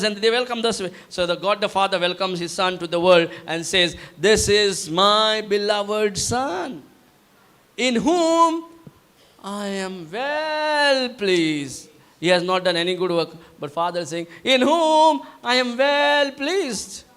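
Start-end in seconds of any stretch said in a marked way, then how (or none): 4.2-11.9 grand arena-style introduction, drawn out; wrestling announcer. Acted. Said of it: "with the same accent". 14.4-17.0 slow, higher, drawn-out proclamation; grand arena-style line, stretched; wrestling announcer. Acted. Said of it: "with the same accent".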